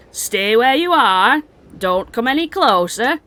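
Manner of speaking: slow, drawn-out command to halt; firm, slowly stretched warning to intruders; cave guard's warning. Acted. Cave dweller halts approaching strangers, slow firm warning. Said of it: "and she says".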